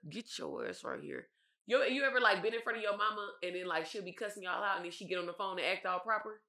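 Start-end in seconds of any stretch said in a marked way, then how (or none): 0.1-1.3 low, slow, soft stern mother; quiet threat from an angry mom. Retold or imitated. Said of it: "It was a".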